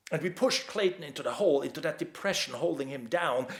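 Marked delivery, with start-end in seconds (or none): none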